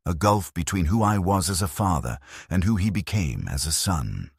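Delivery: plain reading voice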